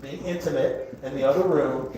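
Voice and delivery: normal talking voice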